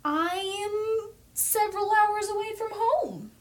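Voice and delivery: high, slow, overplayed woe; hammed-up lament about being far from home; mock dramatic. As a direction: stretch it out like a big tragedy. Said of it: "And I was like".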